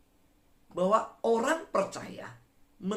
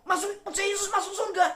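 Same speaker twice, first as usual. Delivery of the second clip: pleading; 14 semitones higher, faster than usual. Retold or imitated. Evangelist pleading with neighbour.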